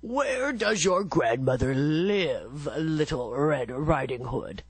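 low, put-on wolf voice; the wolf sweet-talking the girl, low; sly wolf. A narrator's reading. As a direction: greet her like a sly wolf.

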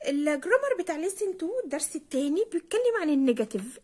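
ordinary delivery

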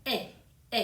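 "เอ" as a lone "eh"; single vowel demo. Shown as a demonstration. Teacher introducing a monophthong, "เอ" pronounced as a single vowel. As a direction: model "เอ" as one pure vowel, twice. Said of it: "สระเดี่ยวนะคะ เสียงเอ".

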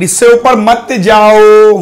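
high, loud, slow call with stretched vowels; loud drawn-out warning. Real, not acted. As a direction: call it out, dragging the last word long. Urging class over time limit.

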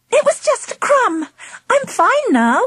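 put-on high-pitched prankster girl; giggly girl. A narrator's reading. Angela to her mum after faking choking, giggly. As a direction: airily brush it off, high-voiced. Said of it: "she giggled".

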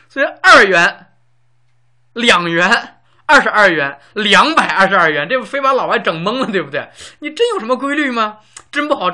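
loud, animated explaining; lively teacher pressing the point. Real, not acted. Teacher marvelling at 二 versus 两 to students, loud animated explanation. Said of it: "lively explanation of 二 and 两".

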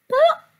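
squeaky high "plop"; high comic plop. A narrator's reading. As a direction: pop the word up high. Sound word read with effect.

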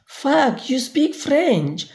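high, amazed exclamation; surprised. Acted. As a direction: blurt it out, delighted and impressed. Surprised reaction to foreign word.

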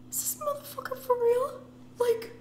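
very high disbelieving squeak; incredulous high. Retold or imitated. Reading divorce papers, high stunned disbelief. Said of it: "I was just like".

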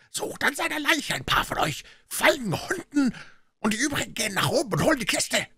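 high, strained voice of command; scolding call. A narrator's reading. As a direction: chide them while giving orders. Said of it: "fluchte wieder und schalt sie".

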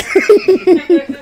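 high, loud burst of laughter; real, helpless laugh. Real, not acted. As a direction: crack up at your own remark. Laughs at own disclaimer.

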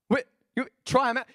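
ordinary talk, nothing marked

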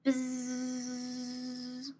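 long soft buzzing bee. Acted. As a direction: buzz softly like a bee. Imitating a bee.

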